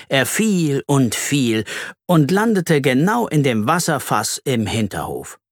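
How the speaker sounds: ordinary delivery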